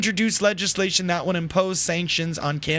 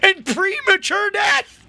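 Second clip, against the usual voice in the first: high laughing words, slow; 13 semitones higher, 4 dB louder, slower than usual. Real, not acted. Laughing at his own ad parody.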